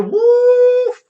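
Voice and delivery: long, loud, high whoop. Real, not acted. Player reacts to being served a drink, high whoop. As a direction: let out a piercing whoop.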